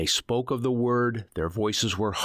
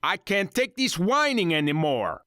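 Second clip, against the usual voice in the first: angry yell; 11 semitones higher than usual. Acted. Ringleader fed up with her whining, angry yell. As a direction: rage at the noise.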